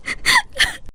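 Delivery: a high, sudden sob that falls away; choked sob. Acted. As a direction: let one sob break out. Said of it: "너 우는 거야?". (흐느끼는 울음소리)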